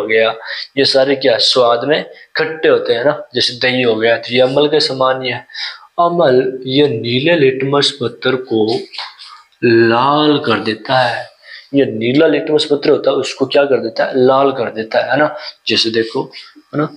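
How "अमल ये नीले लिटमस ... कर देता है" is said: slow dictation. Shown as a demonstration. Teacher stresses the key definition.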